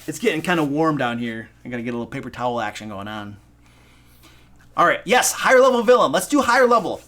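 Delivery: ordinary delivery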